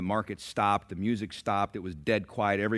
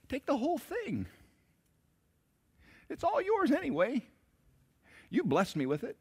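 joyful giving. Acted.

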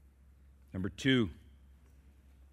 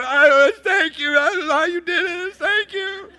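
choked-up thanks; 11 dB louder than usual. Retold or imitated. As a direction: thank dad with a catch in the throat. Son calling dad, overwhelmed.

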